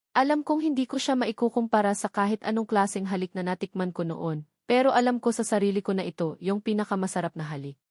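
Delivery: plain reading voice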